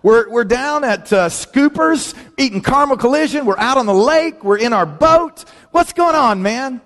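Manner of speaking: high, cheery buddy calling from the lake; bubbly high buddy voice; excited buddy on the phone. Retold or imitated. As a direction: chirp it like a happy friend on holiday. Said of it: "He says".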